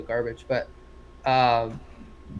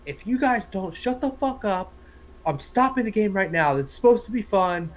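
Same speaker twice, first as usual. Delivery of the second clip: higher-pitched scolding from a ref; retold stern telling-off; imitated angry ref; 6 semitones higher than usual. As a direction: play a fed-up ref warning both teams. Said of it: "the ref stops the game".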